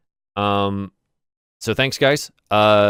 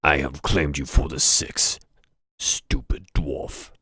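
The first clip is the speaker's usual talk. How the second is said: low, slow gloating orc; a low orc savoring victory, slowly; 7 semitones lower, slower than usual. Acted. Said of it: "this orc that says".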